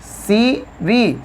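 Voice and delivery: slowly stretched out; slow demo. Shown as a demonstration. Teacher slowing down so students hear it. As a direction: draw CV out very slowly for the class. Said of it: "ध्यान से देखें तो CV".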